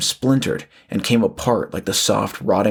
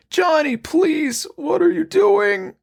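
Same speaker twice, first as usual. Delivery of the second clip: much higher and slow, creature begging its son; raspy pleading; slower than usual. Acted. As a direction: drag out a monstrous plea for help. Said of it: "the thing on the ground pleaded".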